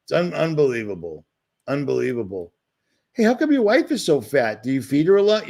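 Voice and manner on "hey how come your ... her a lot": high, nasal-free mocking quote of a troll; mock rude commenter. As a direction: lift the voice high to mimic a rude viewer.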